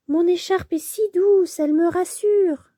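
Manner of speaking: lightly voiced little squirrel, tender; a child squirrel clinging to its scarf; Maury the squirrel. (petit écureuil attendri)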